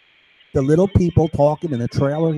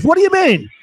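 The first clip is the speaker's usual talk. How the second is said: much higher, louder accusing voice; voicing the other guy; 14 semitones higher, 5 dB louder than usual. Second voice accusing his partner, high and loud. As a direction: squeal the accusation in a high pitch. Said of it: "The other one goes".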